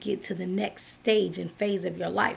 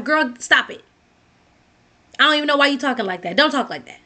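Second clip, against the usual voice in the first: mock scolding, louder and a little higher; dismissive friend; 3 semitones higher, 4 dB louder than usual. Unsupportive friend shutting down a sad caller, dismissive scolding. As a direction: cut them off with an impatient telling-off.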